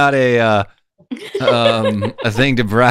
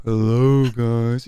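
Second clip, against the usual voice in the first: Eeyore voice; 2 semitones lower, much slower than usual. Retold or imitated. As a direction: drag it out like Eeyore. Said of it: "You sound like Eeyore".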